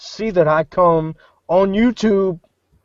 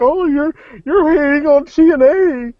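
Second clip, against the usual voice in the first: mocking fan; 8 semitones higher, 5 dB louder than usual. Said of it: "they're like".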